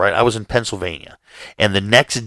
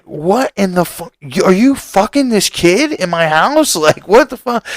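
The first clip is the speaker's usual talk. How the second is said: higher, slow, building outrage; outraged; 9 semitones higher than usual. Retold or imitated. Homeowner catches his roommate with a kid, outraged. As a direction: pile on the disbelief, rising to a yell.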